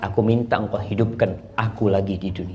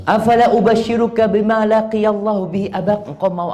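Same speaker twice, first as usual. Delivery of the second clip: high, louder announcing tone; voiced Prophet; 9 semitones higher, 4 dB louder than usual. Preacher voicing the Prophet offering Jabir glad tidings, raised. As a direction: offer the good news eagerly.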